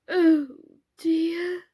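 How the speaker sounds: high, fretful "oh dear"; a high little moan of dismay; dismayed doll. A narrator's reading. Mr Plantagenet sighing at the dusty house, high and fretful.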